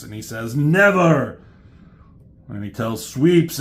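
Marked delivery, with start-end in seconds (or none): 0.6-1.4 loud, higher heroic refusal; hammed-up heroic defiance; defiant cry. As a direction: shout it back in defiance. Acting out hero's defiant refusal.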